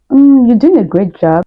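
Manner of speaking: mock overly encouraging tone; sugary fake praise. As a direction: sweeten it like a polite Canadian coworker. Mimics sugar-coated Canadian feedback.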